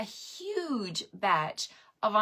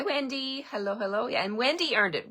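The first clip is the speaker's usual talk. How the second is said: ordinary delivery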